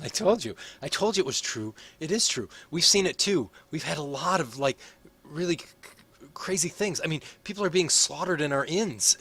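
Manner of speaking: high, urgent, rushed insistence; high excited voice. Acted. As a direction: blurt it out, desperate to be believed. Excited character insisting it's true.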